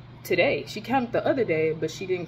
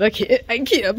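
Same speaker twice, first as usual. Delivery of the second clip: exasperated outburst; 4 semitones higher, 6 dB louder than usual. Real, not acted. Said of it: "I'm so fucking mad".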